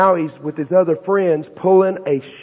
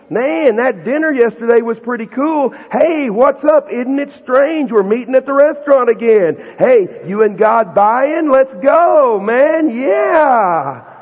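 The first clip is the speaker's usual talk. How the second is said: higher, louder, slow and showy crowd voice; mocking freeloading crowd; 6 semitones higher, 4 dB louder, slower than usual. Preacher mocking the crowd that wants a free meal, showy and loud. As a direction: ham up the crowd begging for free food. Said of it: "What they're saying is".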